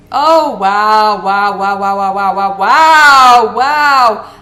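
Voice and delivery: loud repeated wows, rising in disbelief; exasperated wow. Real, not acted. Fed up with the game opponent, loud stream of wows. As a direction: blast out wow after wow at the screen.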